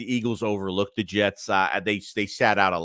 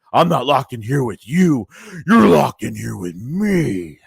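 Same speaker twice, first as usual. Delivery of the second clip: loud, drawn-out movie line; movie character's menace; 6 dB louder, slower than usual. Acted. Host quoting a favorite movie scene to viewers, loud hammed-up menace. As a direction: belt it out as a dramatic film threat. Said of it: "he's like".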